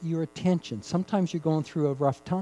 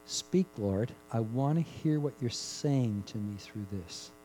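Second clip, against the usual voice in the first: humble prayer; 4 semitones lower, 7 dB quieter than usual. Retold or imitated. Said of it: "No, you say".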